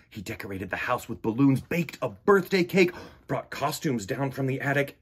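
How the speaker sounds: ordinary delivery, nothing marked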